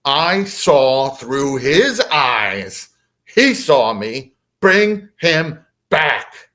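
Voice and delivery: very slow, emphatic mantis boom; imitated alien boom, slow and heavy. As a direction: draw out each word like a booming command. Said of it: "the mantis boomed again".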